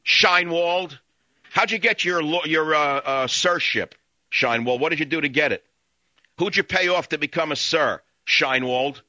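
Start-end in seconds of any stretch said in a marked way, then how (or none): none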